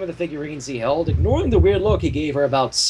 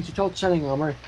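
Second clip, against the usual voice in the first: usual speaking voice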